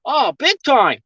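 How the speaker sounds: playful put-on Australian accent; imitated Aussie accent. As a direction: mimic an Aussie, hitting the vowels hard. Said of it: "your Aussie accent".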